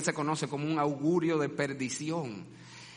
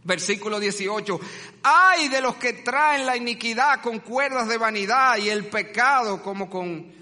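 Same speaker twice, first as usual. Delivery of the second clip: preaching woes; 6 semitones higher, 7 dB louder than usual. Acted. Reading the woes with force.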